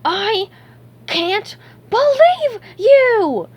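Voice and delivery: slow, high, loud exasperation; furious drawn-out cartoon yell; fuming. A narrator's reading. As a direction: shout it word by word in outrage. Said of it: "SpongeBob fumed".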